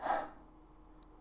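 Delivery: breathy, quiet sigh; soft exhale. A narrator's reading. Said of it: "The other pony exhaled softly".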